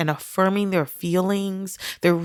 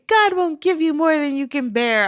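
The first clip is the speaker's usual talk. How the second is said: a light mock, sing-song and higher; mocking a cliché; 8 semitones higher than usual. Mimicking people who offer grieving friends clichés, mocking. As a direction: parrot the empty platitude with scorn. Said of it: "If I hear one more person say".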